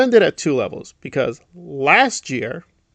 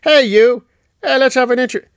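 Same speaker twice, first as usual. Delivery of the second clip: higher, louder, hammed-up calling out; mock eager Aaron; 8 semitones higher, 5 dB louder than usual. Mocking Aaron calling out to a stranger, hammed-up and loud. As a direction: call out to the stranger like a pushy interviewer.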